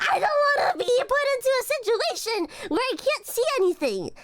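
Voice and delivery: hammed-up terrified whine; mock panic. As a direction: cry it out like you dread the dark. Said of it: "I'm terrified".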